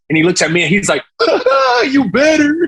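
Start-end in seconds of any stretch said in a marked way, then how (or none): 1.2-2.7 high-pitched burst of laughter into the words; imitated laughing. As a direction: laugh it out, imitating an amused encourager.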